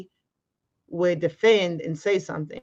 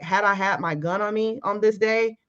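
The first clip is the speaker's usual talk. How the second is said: ordinary talk, nothing marked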